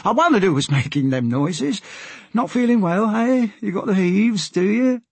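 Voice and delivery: high-pitched common sailor voice; chatty deckhand, pitched well up; sailor character. Acted. Sailor asks passenger about being seasick, high sailor voice.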